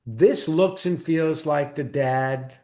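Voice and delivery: usual speaking voice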